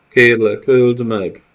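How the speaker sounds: "öld meg!" begged loud through tears; collapsing girl pleading "öld meg!" in tears; sobbing plea. A narrator's reading. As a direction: beg "öld meg!" as she breaks down. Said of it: "zokogva összeomlott".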